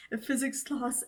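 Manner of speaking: ordinary talk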